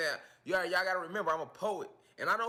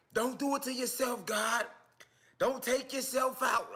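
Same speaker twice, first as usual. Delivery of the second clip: worried fan; 10 semitones higher, 5 dB louder than usual. Retold or imitated. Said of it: "Motherfuckers emailing me like".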